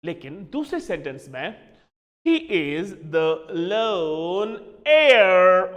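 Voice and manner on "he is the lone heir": very slow reading, "heir" drawn out loud like air; careful read-out. Shown as a demonstration. Teacher reads board sentence to students, slow emphatic "heir". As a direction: stretch each word, land loudly on heir. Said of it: "Let me read them again for you".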